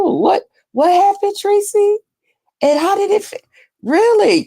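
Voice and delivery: high, eager gossip questions; excited gossip. Real, not acted. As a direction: squeal for the juicy details.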